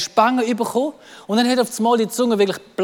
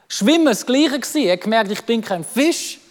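normal talking voice

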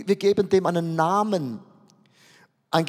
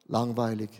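low, much softer, glum and bored; 10 semitones lower, 6 dB quieter than usual. Pretend. Preacher voices how listeners feel about everyday life, glum and soft. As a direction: sink the word like a weary sigh. Congregation's dull everyday named.

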